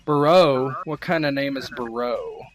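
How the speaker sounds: loudly mangle the name "Barreau"; mispronouncing Barreau. Acted. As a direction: say "Barreau" wrong, like an unfamiliar foreign name. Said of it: "Obviously mispronouncing it".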